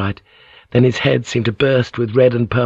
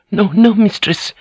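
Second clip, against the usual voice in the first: high-pitched tearful protest; weeping maid; 11 semitones higher, 3 dB louder than usual. A narrator's reading. Maid begs her mistress not to die, weeping. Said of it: "the maid wept".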